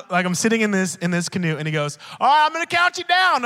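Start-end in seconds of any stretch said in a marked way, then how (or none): 2.2-3.5 higher, louder grandpa call. Retold or imitated. Grandpa calling to grandson in canoe, loud. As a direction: call out the countdown plan.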